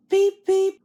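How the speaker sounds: high, loud beep beep like a train horn; little engine tooting its horn. A narrator's reading. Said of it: "answered Thomas".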